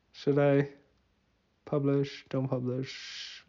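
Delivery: ordinary talk, nothing marked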